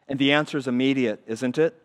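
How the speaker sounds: ordinary talk, nothing marked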